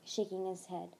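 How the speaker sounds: ordinary delivery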